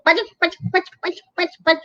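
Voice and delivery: put-on playful eating noise for kids; pecking sound. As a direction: peck out the syllables like gobbling beaks. Ducks gobbling the bread.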